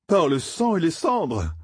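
juron lent et haut perché; lâche le juron en montant dans l'aigu; exclamation. A narrator's reading. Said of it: "S'exclama Matt". (exclamation aiguë, appuyée)

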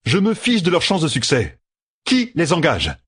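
high, pressing demand. A narrator's reading. Matt presses Jaime for the name of the one paying. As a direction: insist on a name, impatient.

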